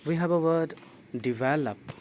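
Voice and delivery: ordinary talk, nothing marked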